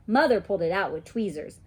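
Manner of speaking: ordinary delivery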